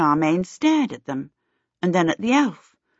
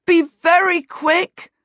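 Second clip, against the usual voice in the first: much higher, louder urgent call; a boy calls after the girl running off; shout; 12 semitones higher, 5 dB louder than usual. A narrator's reading. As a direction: yell it after her as she runs. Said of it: "Peter shouted after her".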